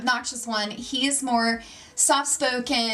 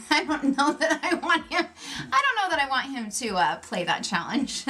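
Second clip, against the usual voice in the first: laughing through the words, pitched up; giggly, hesitant reluctance; amused doubt; 3 semitones higher than usual. Real, not acted. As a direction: chuckle as you voice your doubts. Amused doubt about challenge for husband.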